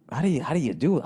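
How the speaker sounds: ordinary delivery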